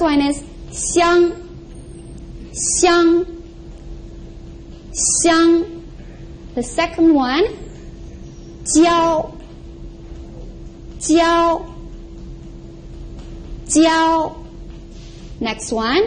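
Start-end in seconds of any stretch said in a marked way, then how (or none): 0.7-5.8 xiang held flat and a bit high; first tone. Shown as a demonstration. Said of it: "the first tone". 8.6-14.4 jiao held high and level; first tone. Shown as a demonstration. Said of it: "It's flat and it's a little bit high".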